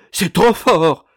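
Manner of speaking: very loud, high outraged cry; choking rage. A narrator's reading. Madame left behind by her son-in-law, choking with rage. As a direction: explode in a fit of fury. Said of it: "étouffait madame Malicet au paroxysme de la rage".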